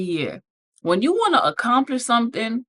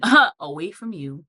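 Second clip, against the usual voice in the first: sassy dismissive. Pretend. Mock reply to catcaller.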